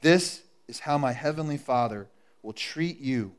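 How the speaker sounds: ordinary delivery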